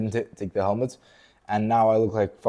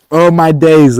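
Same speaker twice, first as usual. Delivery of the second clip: higher, loud, quick oh my days; sharp excited exclamation; 5 semitones higher, 14 dB louder, slower than usual. Real, not acted. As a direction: blurt it out thrilled. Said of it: "why I'm so excited right now".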